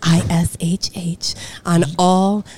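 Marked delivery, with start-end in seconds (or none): 0.0-1.3 slow whispered spelling I S H H. Shown as a demonstration. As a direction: spell I S H H slowly, breathy. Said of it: "I only put Takyo-ish".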